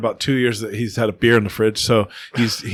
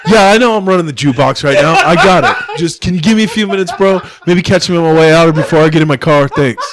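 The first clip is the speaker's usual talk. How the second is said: laughing, louder put-upon celebrity voice; mock diva celebrity; 4 semitones higher, 5 dB louder than usual. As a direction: play the annoyed local star shooing a fan while cracking up. Mocking annoyed celebrity, laughing.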